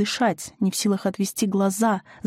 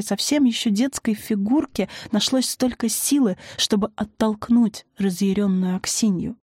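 ordinary delivery

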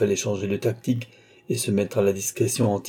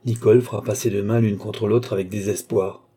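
ordinary delivery

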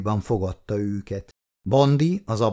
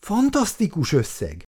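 scandalized; 8 semitones higher than usual. Acted. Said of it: "botránkozott meg az asszony".

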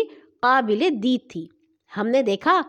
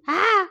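short high-pitched cry; sudden little yelp; small scream; 9 semitones higher, slower than usual. A narrator's reading. A faint scream rings out in the night, small scream. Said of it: "ایک ہلکی سی چیخ گونجی".

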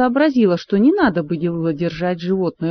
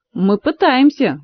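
usual speaking voice

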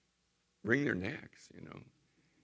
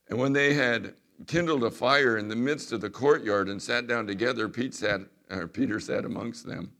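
loud, raised pulpit reading of the Gospel; scripture reading; 9 dB louder than usual. Acted. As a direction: proclaim the verses to the congregation. Reading scripture aloud to congregation.

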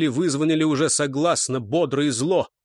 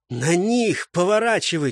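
ordinary delivery

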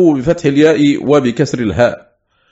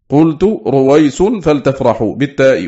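ordinary talk, nothing marked

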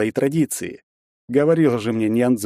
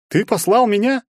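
very high, indignant shout; outraged cry; 13 semitones higher, 4 dB louder than usual. A narrator's reading. Bully unable to believe the insult, crying out indignantly. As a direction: yelp it in disbelief. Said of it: "закричал тот".

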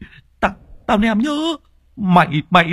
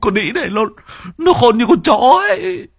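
frightened whining; 13 semitones higher than usual. Acted. Shivering old man wants home.

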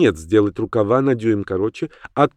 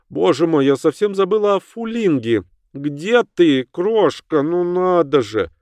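surprised exclamation; 8 semitones higher, slower than usual. Acted. Lady exclaims in character voice.

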